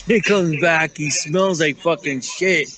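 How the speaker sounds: usual speaking voice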